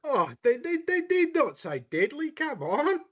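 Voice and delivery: mock indignant. Denying deadly snakes to the host in mock high-pitched outrage. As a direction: pretend to be offended, squeaking.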